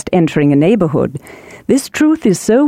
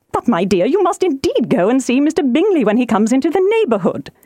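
higher-pitched, urging matron; wifely nagging, pitched up; excitable wife, insistent; 6 semitones higher than usual. Acted. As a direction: press the husband to agree. Voices insistent Mrs Bennet.

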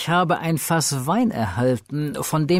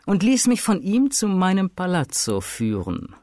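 ordinary delivery

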